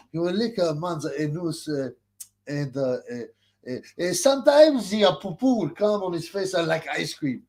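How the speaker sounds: ordinary delivery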